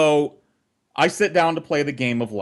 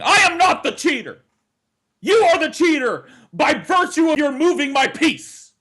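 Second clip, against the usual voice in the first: furious tirade; 13 semitones higher, 5 dB louder than usual. Retold or imitated. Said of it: "In the middle of this tirade".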